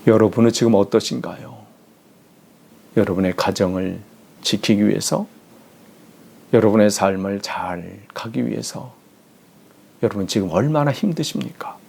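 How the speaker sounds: ordinary talk, nothing marked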